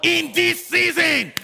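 high, loud prophetic preaching; full-force preaching. Real, not acted. Preacher prophesying life over the congregation, full-force preaching. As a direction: declare it in long shouted cries.

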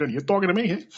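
jokey film-star impression with rising end; Robert De Niro. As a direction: lightly imitate a movie tough guy's question. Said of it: "feel like Rob".

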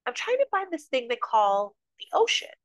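put-on young fish voice. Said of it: "He swims up to the older fish and says".

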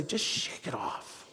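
whispered, slow and soft. Shown as a demonstration. Preacher has the congregation whisper to each other. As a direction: breathe it to your neighbour. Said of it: "look at someone and say".

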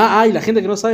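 ordinary talk, nothing marked

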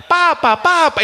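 high, dramatic Italian exclamation; high-pitched mock Italian cry. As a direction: imitate a dramatic Italian film line. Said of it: "En italiano s-, suena más dramático".

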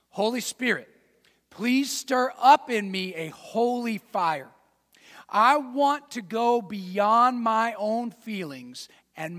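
slow, fervent spoken prayer, lifting on key lines; earnest prayer. Shown as a demonstration. Preacher leading the congregation in a slow, fervent prayer. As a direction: pray it out loud like you mean it. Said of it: "Let's literally say it out loud".

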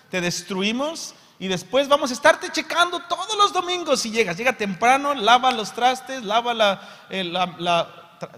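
high, loud, sarcastic sermon rant; mock the judgmental church, full force; preacher lampooning religious condemnation, loud and high; loud mocking preaching. Real, not acted.

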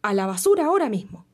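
fast, loud barked command; furious order. Acted. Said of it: "el rey dijo furioso y ordenó".

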